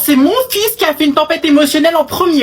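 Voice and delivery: loud, high, rapid protest; insistent shouting mother. Acted. Mother shouting down the other parent. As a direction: shout her down with a string of nons. Mothers quarrel over sons.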